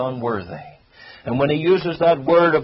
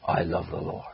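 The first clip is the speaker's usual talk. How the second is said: near-whispered, much softer "I love the Lord"; hushed confession; 7 semitones lower, 10 dB quieter than usual. Acted. Preacher closing the point to the congregation, hushed and soft. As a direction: breathe "I love the Lord" almost unvoiced, intimate. Hushed climax of repeated refrain.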